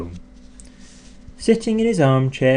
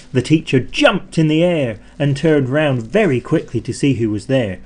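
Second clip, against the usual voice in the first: usual speaking voice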